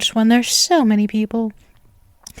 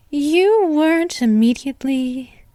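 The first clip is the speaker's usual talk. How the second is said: ordinary talk, nothing marked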